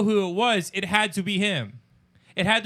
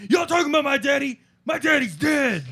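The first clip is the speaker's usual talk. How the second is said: high, loud put-on voice of an odd stranger; strange man's shout; 10 semitones higher, 6 dB louder than usual. Retelling the man who interrupted them, high loud shout.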